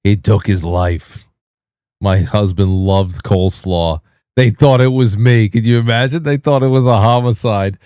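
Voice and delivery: a hint of oversharing Long Island waitress; replaying the waitress's overshare; lightly imitated waitress. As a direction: lightly mimic a diner waitress confiding. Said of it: "a waitress in Long Island".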